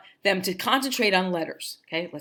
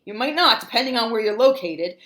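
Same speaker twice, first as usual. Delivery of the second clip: usual speaking voice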